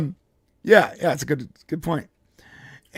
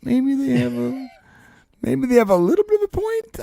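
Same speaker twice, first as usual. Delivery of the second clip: higher, grudging mock admission; much higher hesitant grudging voice; reluctant concession; 12 semitones higher than usual. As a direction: concede it slowly, half joking. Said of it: "and I'm like".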